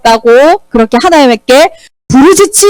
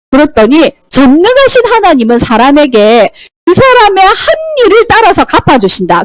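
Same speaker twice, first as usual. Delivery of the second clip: usual speaking voice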